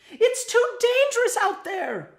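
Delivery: slow, very high, loud warning; mother's shriek. A narrator's reading. Mother warning her little wormling of danger, shrieking. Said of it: "she shrieked".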